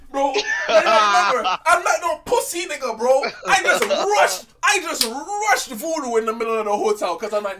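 high, loud and worked up, laughs breaking in; loud, laughing outburst. Real, not acted. Storyteller insisting he rushed Voodoo, loud and cracking up. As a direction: shout it through your own laughter.

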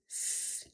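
S as a long, unvoiced hiss; isolated S. Shown as a demonstration. Teacher breaks down the first syllable, hissed S. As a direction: hiss the S on its own. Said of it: "trzy najważniejsze dźwięki".

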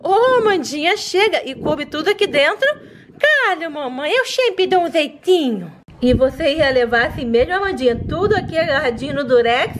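low reassuring mom; mother voice. Acted. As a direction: soothe the little one as mom. Mother scolds baby gently.

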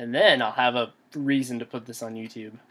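ordinary talk

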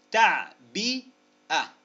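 "تعبئة" in three long, separate syllables. Shown as a demonstration. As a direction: sound out "تعبئة" piece by piece. Sounding out word for spelling.

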